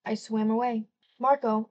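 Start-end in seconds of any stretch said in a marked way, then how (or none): none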